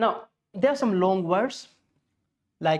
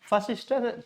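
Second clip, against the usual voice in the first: normal talking voice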